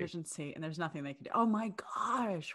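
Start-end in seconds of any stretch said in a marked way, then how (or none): 0.0-1.3 much softer, stunned and hushed; barely audible disbelief. Real, not acted. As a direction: let it drop away in shock. Shocked listener trails off.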